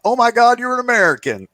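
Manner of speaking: high, loud mock astonishment; surprised exclamation. Stranger spotting a fellow American, high mock surprise. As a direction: imitate an amazed stranger in a high voice. Acted quote of surprised reaction.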